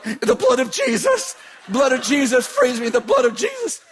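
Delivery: high, frantic mock shouting; hammed-up terrified plea; panicked cries. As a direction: cry it over and over, high and desperate. Frantic cries while being choked.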